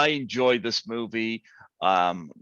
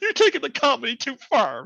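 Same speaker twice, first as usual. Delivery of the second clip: laughing; 4 dB louder than usual. Real, not acted. Laughing at own bit.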